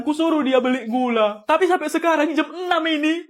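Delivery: usual speaking voice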